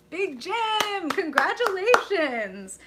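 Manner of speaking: loud, high cheer; excited congratulations. Real, not acted. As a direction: cheer them on, drawing out the word. Cheering a viewer's good news.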